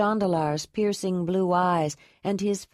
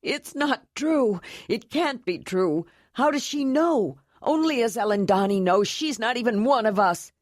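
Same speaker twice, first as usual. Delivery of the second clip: distraught denial; 5 semitones higher than usual. Acted. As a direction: fight the truth with rising panic. Young man denies brother's dying.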